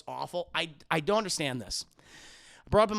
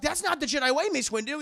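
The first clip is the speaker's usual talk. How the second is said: higher, louder indignant objection; mock protest; 8 semitones higher, 6 dB louder, faster than usual. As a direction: protest like an upset apprentice. Mimics Anakin objecting in quarrel.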